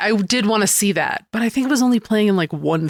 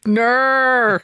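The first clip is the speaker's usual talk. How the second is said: higher, drawn-out mock Australian "ner"; 4 semitones higher than usual. Co-host echoing the bad impression, mock Australian accent. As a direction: echo a silly Australian no. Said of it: "here's Mister Wayne as an Australian".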